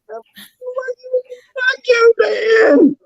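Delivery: indignant shout. Retold or imitated. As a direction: yell it like you can't believe they ignored you. Quoting his own reaction in store.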